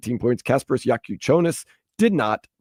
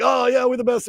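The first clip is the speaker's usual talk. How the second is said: gloating fan; 11 semitones higher than usual. Retold or imitated. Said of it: "I'm gonna be like".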